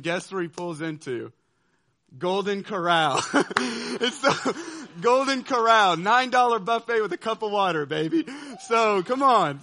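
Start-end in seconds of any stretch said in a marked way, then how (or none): 2.2-9.6 loud, higher, laughing through the words; laughing disbelief. Real, not acted. Telling the crowd where the bus stopped, loud laughing. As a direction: crack up at the punchline.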